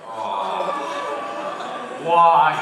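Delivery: loud, high drawn-out why; mocking the roommate's whiny why; roommate impression. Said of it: "Sound like my roommate".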